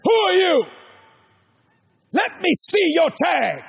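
the floor man confronting the preacher, shouting; hostile challenge. Retold or imitated. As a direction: bark it like a suspicious guard. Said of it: "this guy stands up and says".

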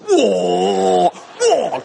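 drawn-out, goofy whoa; stupidly awed men. Retold or imitated. Guys on a plane see a beautiful woman walk in, stupidly awed. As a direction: imitate dumbstruck guys gawking. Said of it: "they get stupid".

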